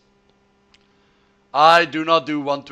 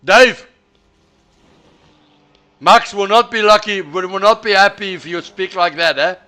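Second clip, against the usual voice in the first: mock warning; 3 semitones higher, 8 dB louder than usual. Real, not acted. Teasing warning to Dave.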